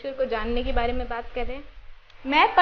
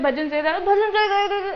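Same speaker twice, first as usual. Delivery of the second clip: rushed repeating; 6 semitones higher than usual. Shown as a demonstration. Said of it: "दोहराना मतलब एकदम speed में मैं बोल रही हूँ".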